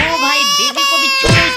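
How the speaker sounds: put-on high, loud panicked cry to let the baby go; alarmed shout. Shouting at the toy attacker to spare the baby, loud alarm. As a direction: yell at the toy animal to drop the baby.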